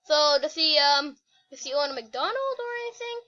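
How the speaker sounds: ordinary talk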